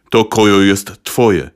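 low, slow doglike growl; growling dog voice. Acted. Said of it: "warknął po niemiecku".

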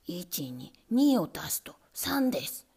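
low, slow, whispered lesson; lightly voiced nervous rat; rat teacher. A narrator's reading. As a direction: recite the sum carefully.

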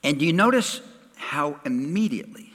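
usual speaking voice

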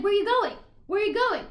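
loud, high retold call; imitated urgent shout; calling after someone. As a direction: act out hollering after someone walking off. Voicing man chasing after her.

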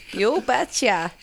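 slow, drawn-out Minnesotan catchphrase; Minnesotan accent. Acted. Guest offering the local saying, slow Minnesotan accent. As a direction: stretch it out in Minnesotan. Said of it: "saying was that Minnesotans and Canadians say".

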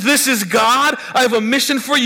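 high, loud eager version of the voice; excited retelling. Acted. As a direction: gush as if thrilled by the call. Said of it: "you hear this voice, and it says".

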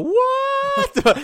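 very high, drawn-out disbelieving squeak; shocked squeal. Retold or imitated. Everyone hearing the full-court boast, squealing high. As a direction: squeak like the claim is absurd.

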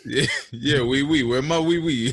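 high, loud joking French; mock French. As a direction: toss in a hammy French oui. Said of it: "talking French".

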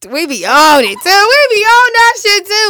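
hyped up. Real, not acted. Hyping up their daily grind.

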